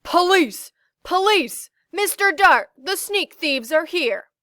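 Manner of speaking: yelled high and loud, full force; loudest terrifying shout. A narrator's reading. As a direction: shout for the police with all his might. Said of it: "he shouted in his loudest, most terrifying voice".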